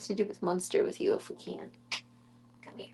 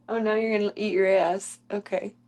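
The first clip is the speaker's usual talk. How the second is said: ordinary talk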